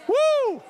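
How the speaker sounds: high loud whoop of praise; joyful whoop. Real, not acted. Preacher whooping at his congregation, high and loud. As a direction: let out a big excited woo.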